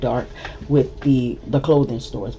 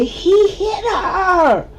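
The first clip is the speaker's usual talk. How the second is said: high, slow, stretched outcry; alarmed cry; 4 dB louder, much slower than usual. Retold or imitated. Sister-in-law reacting to the punch, high alarmed cry. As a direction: cry it out in disbelief.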